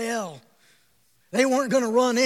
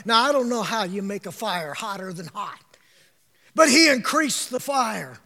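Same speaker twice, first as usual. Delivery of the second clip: ordinary talk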